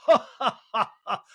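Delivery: imitated throaty cackle, starting high and loud; throaty laugh. Host imitating a housewife cracking up, throaty mock laugh. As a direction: burst into a put-on throaty laugh. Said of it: "Garcelle's throaty laugh".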